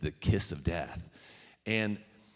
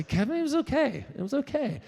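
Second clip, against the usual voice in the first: high mock voice, louder; a pretend listener reporting back in a squeaky voice; audience voice; 5 dB louder than usual. Audience member telling Kevin it was okay, high mock voice.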